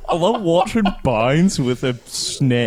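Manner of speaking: slow, put-on Australian accent; hammed-up Australian drawl. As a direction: stretch the vowels like a mock Aussie. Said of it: "doing his accent".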